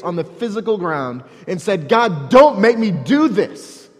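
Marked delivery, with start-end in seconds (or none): 1.9-3.9 louder, pleading cry to God; anguished plea. Retold or imitated. Preacher voicing Jesus begging God, anguished plea. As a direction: beg God to spare him.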